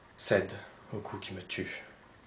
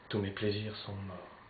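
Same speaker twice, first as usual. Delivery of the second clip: normal talking voice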